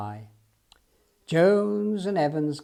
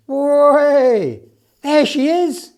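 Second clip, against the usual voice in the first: much higher, louder joyous cry; excited exclamation; 9 semitones higher, 11 dB louder, much slower than usual. Acted. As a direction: cry out on spotting her. Finding the lost elephant.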